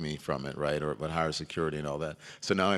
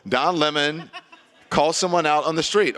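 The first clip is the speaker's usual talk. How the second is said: laughing mock headline; 8 semitones higher, 7 dB louder than usual. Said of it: "It's like".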